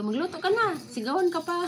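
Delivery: high mock shout of a nagging mom; mother yelling. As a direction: play the shouting mother, pitch up. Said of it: "yung mga nanay natin".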